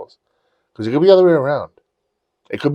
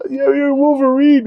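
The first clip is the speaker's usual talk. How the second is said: very high, loud, slow insistence; insistent fan yell; 5 dB louder than usual. Retold or imitated. Fan answering the actor on stage, insistent yelling. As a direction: call it back, stretched high.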